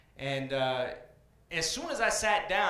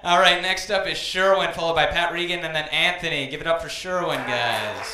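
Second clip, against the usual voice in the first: emcee call-out; 10 dB louder than usual. Real, not acted. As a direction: hype up the next comic for the crowd. Host calls out the lineup.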